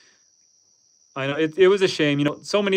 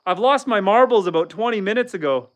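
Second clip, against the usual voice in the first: usual speaking voice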